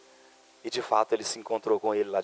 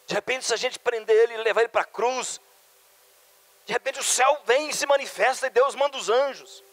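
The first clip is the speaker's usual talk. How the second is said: voz que sobe frase a frase; instigação crescente; 11 semitones higher, 5 dB louder than usual. Acted. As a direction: build higher and higher, egging someone on. Imagined schemer urging Judas on. (instigando, cada vez mais agudo)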